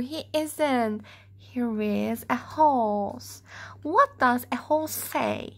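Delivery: usual speaking voice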